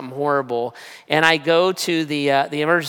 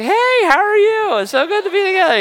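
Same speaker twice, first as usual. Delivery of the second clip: much higher and louder, forced bright greeting; faked pastor cheer; 4 dB louder than usual. Hurting pastor greets the X-ray tech with faked cheer. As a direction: put on a happy pastor voice through the pain. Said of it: "so I faked it".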